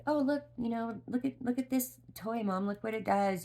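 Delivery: put-on eager little girl calling mom to look; excited child voice. Daughter showing mom a toy after crying, high child voice. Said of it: "She's like".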